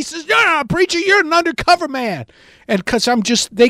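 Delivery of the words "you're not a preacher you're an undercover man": high-pitched disbelieving retort; acted accusation. Heckler calling the preacher an undercover man, accusing. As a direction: accuse him in a high, heated voice.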